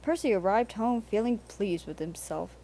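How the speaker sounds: usual speaking voice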